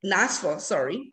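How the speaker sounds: ordinary delivery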